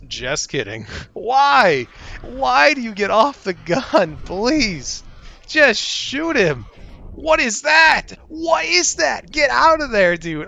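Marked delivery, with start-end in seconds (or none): 1.1-10.5 high, loud panicked yelling; frantic. Real, not acted. Gamer yelling at crew mid-fight, panicked high voice.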